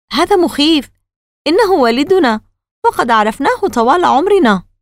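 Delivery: put-on a scared young boy speaking up; frightened boy. A narrator's reading. Boy Casey voiced in story. (صبي خائف بصوت طفولي مرتفع)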